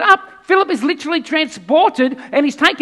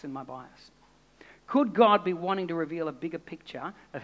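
much softer, lower reflective preaching; intimate sermon; 8 semitones lower, 10 dB quieter than usual. Acted. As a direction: draw them in close and quiet.

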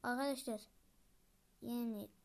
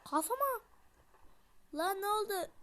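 much higher, loud startled cry; startled shout; 8 semitones higher, 5 dB louder than usual. Real, not acted. Gamer startled by a sudden hit, high loud yelp. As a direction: yelp as something hits you.

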